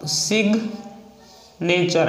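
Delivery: सिग्नेचर drawn out very slowly; slow demo of सिग्नेचर. Shown as a demonstration. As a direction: stretch out सिग्नेचर. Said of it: "next है सिग्नेचर".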